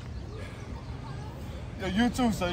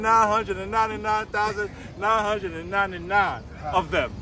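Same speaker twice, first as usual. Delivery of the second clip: ordinary delivery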